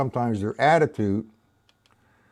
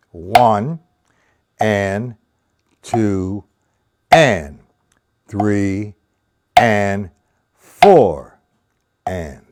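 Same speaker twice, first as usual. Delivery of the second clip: slow count, beats called louder; 4 semitones lower, 6 dB louder, much slower than usual. Shown as a demonstration. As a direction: count it out slowly. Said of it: "I'm gonna do it slow".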